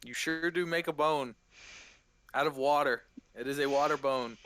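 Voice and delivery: normal talking voice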